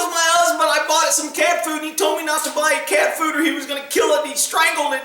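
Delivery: high, distraught woman imitation; retold upset. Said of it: "And she was all upset".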